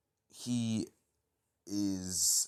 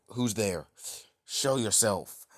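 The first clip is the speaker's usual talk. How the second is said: louder, slow demand into the dark; demanding call; 3 semitones higher, 5 dB louder, slower than usual. Acted. Deku calls into the dark warehouse, demanding. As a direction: call out to the hidden stranger.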